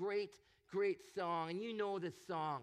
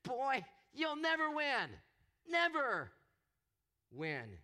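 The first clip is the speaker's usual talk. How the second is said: much higher and louder taunt on never; mocking waves; 7 semitones higher, 6 dB louder, slower than usual. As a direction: jeer it down at him like a bully. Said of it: "they keep on telling me".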